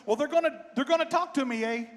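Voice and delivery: high mock-Canadian voice; Canadian accent. Fiddler reminding the driver about border agents, mock Canadian accent. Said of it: "I'll do my best Canadian accent".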